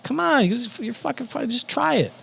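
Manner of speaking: high-pitched imitation of a pestering friend; mock coaxing. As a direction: coax him in a high, put-on voice. Friend pressing him to try.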